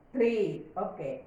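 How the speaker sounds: usual speaking voice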